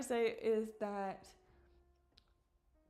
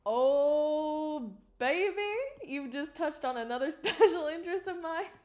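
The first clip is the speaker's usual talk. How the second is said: high, loud, delighted gush; excited gush; 6 semitones higher, 7 dB louder than usual. Real, not acted. Streamer gushing to chat about her special interest, excited. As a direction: burst out with glee at a pet topic.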